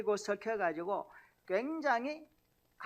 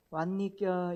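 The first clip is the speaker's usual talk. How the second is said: Gyeongsang greeting with a rising 왔니껴; Andong dialect greeting; 3 dB louder, slower than usual. Shown as a demonstration. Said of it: "안동 사람 어떻게 인사합니까?". (안동 사투리로 "왔니껴?")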